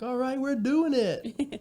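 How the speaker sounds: high, slow, triumphant cheer; proud we finally made it; high excited self-quote. Retold or imitated. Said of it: "feeling like".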